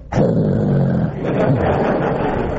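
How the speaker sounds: low mock growl of a little dog; imitated dog growl. Tiny dogs glaring at a jogger, mock growl. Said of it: "they'd look at me and go".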